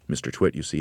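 plain reading voice

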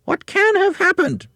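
very high, loud, squeaky wife voice; Missus Twit. A narrator's reading. Wife puzzling over her walking stick, high loud bewildered voice. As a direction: pitch it way up high, loud and baffled. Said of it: "Missus Twit said".

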